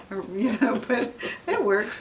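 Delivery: chuckling through the words; laughing. Real, not acted. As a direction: say it while laughing at the memory. Laughing at the bowling PT story.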